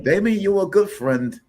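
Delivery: high, slow voiced dog; dog impression. Cohost counters with his own dead dog impression, high and slow. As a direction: lift the voice up and slow it like a loyal dog talking. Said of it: "Stone's impression is closer".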